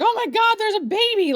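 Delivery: high, amazed cooing; delighted wonder. Retold or imitated. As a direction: coo over a newborn, very high. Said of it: "it's like".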